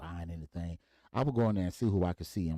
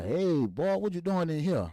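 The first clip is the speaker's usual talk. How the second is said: a touch higher and louder, surprised greeting; dad calling out; 10 semitones higher, 3 dB louder than usual. Retold or imitated. Dad spotting his son in the bank, higher and louder. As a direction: call across the room to your son.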